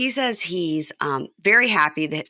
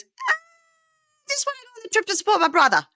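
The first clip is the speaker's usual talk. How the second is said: squeaky falsetto, far higher and fast; high-pitched John impression; faster than usual. Host mimics John pleading, fast falsetto. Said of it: "more high-pitched".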